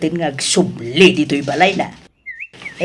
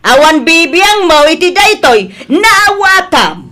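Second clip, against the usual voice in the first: an outraged elder roaring at him; furious scolding; 8 semitones higher, 6 dB louder than usual. Acted. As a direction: lay down the law, yelling.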